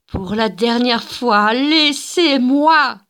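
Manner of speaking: lent, aigu et fort, étiré; hurlement. Acted. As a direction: dernier avertissement hurlé. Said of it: "hurla Gilda". (Gilda hurle aux agents, lente et aiguë)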